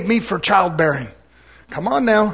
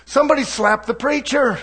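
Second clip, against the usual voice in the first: ordinary talk, nothing marked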